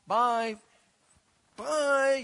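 high, loud, brushing-off byes; flippant goodbye. Retold or imitated. Preacher mocking a flippant churchgoer, high loud careless goodbye. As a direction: wave people off fast and careless. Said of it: "and you're like".

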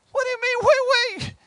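high, alarmed wait wait; mock protest. Believer protesting to the Lord, high alarmed. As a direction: balk like someone told to keep going.

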